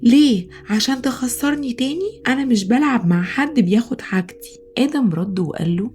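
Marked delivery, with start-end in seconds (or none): 0.0-4.6 higher-pitched, irritated retort; a touch of temper, pitched up; mildly angry. Acted. Boy snapping back at classmate who wants to play, mildly angry. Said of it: "سيف رد بعصبية".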